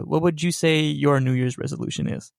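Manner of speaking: ordinary delivery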